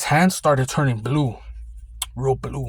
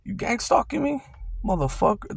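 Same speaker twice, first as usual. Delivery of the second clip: imitating a shrill, much higher accusation; mock angry accusation; 8 semitones higher than usual. The guy accuses the guard, hammed-up high-pitched outrage. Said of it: "And I'm gonna".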